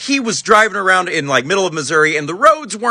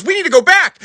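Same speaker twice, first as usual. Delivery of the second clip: high, loud mock insistence; alarmed insistence; 10 semitones higher, 5 dB louder than usual. Mom to her concussed brother, alarmed insistence. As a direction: raise it high and urge him back.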